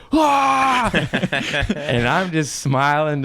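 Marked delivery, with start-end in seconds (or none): 0.1-0.9 high, loud imitated cheer; mock crowd roar. Host imitates the crowd greeting a star, mock roar. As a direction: burst out like an audience leaping up. Mimes crowd's standing ovation.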